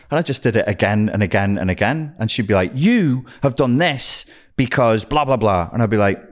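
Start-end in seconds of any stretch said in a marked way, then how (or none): 2.7-5.7 slow, drawn-out, higher-pitched accusing imitation; mock accusation. Partner laying blame on him, slow mock accusation. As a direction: drag out the accusation dismissively.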